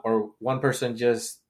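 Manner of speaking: ordinary delivery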